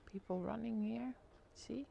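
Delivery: hushed whisper to the viewers. Real, not acted. Whispering to viewers about the runners outside. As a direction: whisper it as if not to be overheard.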